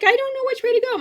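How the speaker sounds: high-pitched distressed whine; retold crying wail. Lost child to mom on the phone, imitated high wail. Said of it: "like, crying 'cause I don't know".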